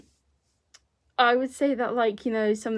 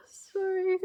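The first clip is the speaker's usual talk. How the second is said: high-pitched laughing sorry; squeaked, giggly apology; embarrassed laughing apology; 11 semitones higher, 7 dB louder than usual. Real, not acted. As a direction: apologize through a laugh at your own slip. Laughing at own voice crack.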